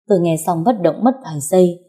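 usual speaking voice

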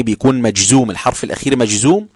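ordinary talk

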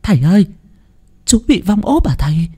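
high alarmed question; frightened cry. A narrator's reading. Woman of the clan asks the priest, frightened. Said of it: "Một bà trong họ kêu lên".

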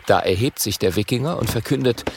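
usual speaking voice

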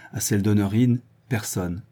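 ordinary delivery

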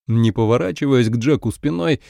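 ordinary delivery, nothing marked

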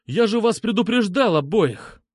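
high, indignant outburst; angry exclamation. A narrator's reading. Narrator scolds both after warning them, angry exclamation. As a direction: exclaim with barely contained anger. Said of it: "воскликнул я, пытаясь хоть как-то унять кипение крови".

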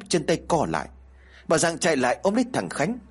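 usual speaking voice

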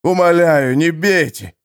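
громкая испуганная мольба, выше голосом; взмолиться, прося пощады; мольба пленника. A narrator's reading. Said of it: "взмолился пленник". (пленник умоляет Хармана не бить его)

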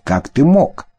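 reproachful. Acted. Героиня упрекает обманщика.

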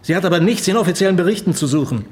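stern rebuke. Acted. Superior ends a political discussion with his subordinate, stern rebuke. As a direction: put the subordinate firmly in his place.

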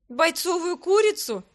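loud, high, drawn-out mock indignation; snorted retort. A narrator's reading. Heroine correcting the emperor's pet name, snorted retort. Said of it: "фыркнула я".

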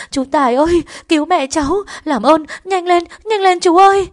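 loud, very high cries for help; terrified shouting at full pitch; panicked scream. A narrator's reading. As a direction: scream frantically for rescue, building to the end. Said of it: "Cô hét lớn hốt hoảng".

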